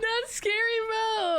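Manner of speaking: high laughing squeal. Real, not acted. Laughing at own weak growl.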